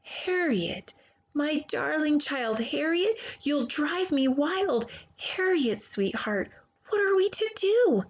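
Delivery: rising strain, bursting on the question; sweet words through gritted teeth; mother at the end of her tether; exasperated mother. Acted. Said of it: "so instead she said".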